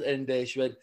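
normal talking voice

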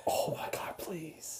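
breathless whispered plea. Real, not acted. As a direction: whisper it under your breath. Whispered plea after e-boy joke.

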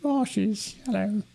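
slow, high mock English accent; a little blob of ashes in a silly English accent; weird English accent. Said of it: "You'd have a really weird English accent".

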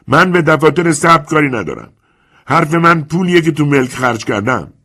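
high, loud furious protest; shouting. Acted. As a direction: hammer the point home. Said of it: "اسکالا فریاد زد".

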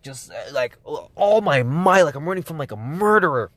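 high, breathless, racing retelling; frantic excited retelling. Retold or imitated. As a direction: tell it like you're still running. Frantic escape retold breathlessly.

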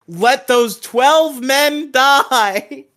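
high, slow, drawn-out mock proclamation; hammed-up decree. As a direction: stretch it out with theatrical outrage. Mocking L's callous decision.

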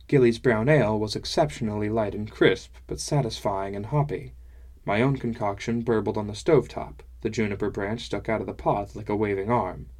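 ordinary delivery

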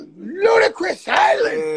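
high, loud, drawn-out exclamation; incredulous holler, slow and stretched; astonished shout. Acted. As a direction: cry it out in mock disbelief. Acted astonishment at island's name.

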